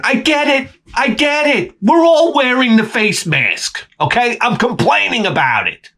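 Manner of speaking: high, whiny, exasperated; mock-whiny complaint, slowed on the mask line; whiny mock protest. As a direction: sing it out like a sulking kid. Mocking himself for the critics.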